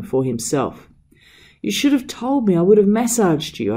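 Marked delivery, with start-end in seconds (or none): 1.6-3.7 high, loud pleading shout. A narrator's reading. Servant insisting he massage his master's feet, pleading shout. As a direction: insist loudly, almost begging. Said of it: "I shouted".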